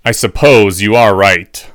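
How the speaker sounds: slow, heavy concession from a professor; resigned. A narrator's reading. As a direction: concede slowly with a resigned smile. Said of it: "said with a resigned smile".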